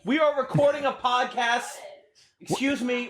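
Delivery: shouting toward another room; calling out. Acted. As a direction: call out to someone elsewhere in the house. Calling out to someone in house.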